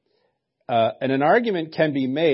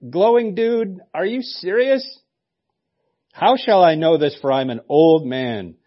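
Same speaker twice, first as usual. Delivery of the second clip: much higher and louder, slowing on "are you serious"; hammed-up shocked old man; loud disbelief; 6 semitones higher, 8 dB louder than usual.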